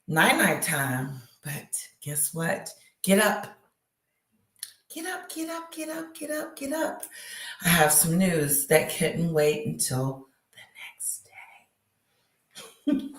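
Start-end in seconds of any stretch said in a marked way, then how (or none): 4.9-7.0 high, hushed sing-song get up; high sing-song wake-up call. Pretend. Calling viewers out of bed in a high hushed sing-song. 10.5-13.1 hushed late-night whisper. Real, not acted. Telling viewers late-night news in a whisper.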